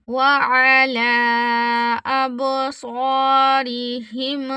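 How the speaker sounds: slow tilawat; measured Quranic chant; Quran recitation. Shown as a demonstration. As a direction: chant the verse, drawing out the vowels. Recites the verse slowly.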